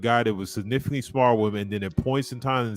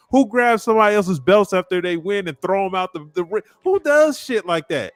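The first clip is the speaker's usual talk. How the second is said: heated rant; 9 semitones higher, 4 dB louder than usual. Real, not acted. Ranting about Joshua's antics.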